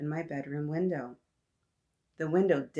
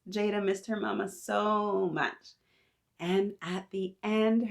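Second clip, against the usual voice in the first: usual speaking voice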